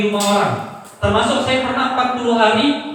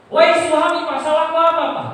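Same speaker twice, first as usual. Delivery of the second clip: loud, high protesting cry; hysterical yelling, re-enacted; 7 semitones higher, 5 dB louder than usual. Son demands of his father what he did wrong, re-enacted hysterical shout. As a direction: shout it as a desperate plea, re-enacted. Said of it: "saya teriak histeris itu".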